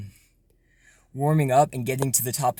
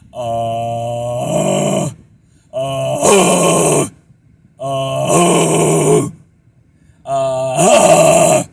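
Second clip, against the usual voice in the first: louder raspy uh climbing up; rising distorted screams; 13 dB louder, much slower than usual. Shown as a demonstration. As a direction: scream up step by step. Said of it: "keep going up like that".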